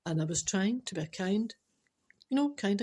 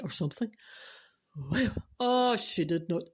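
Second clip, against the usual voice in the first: ordinary talk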